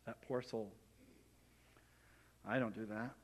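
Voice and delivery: soft, smug pity for the poor sinner; gentle mock pity. Believer pitying a sinner, softly smug. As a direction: say it sweetly, quietly pleased with yourself. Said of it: "Gently and lovingly".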